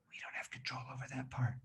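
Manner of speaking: soft, secretive whisper; hushed confiding whisper. Acted. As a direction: let it drop to a whisper. Hushed aside after big build.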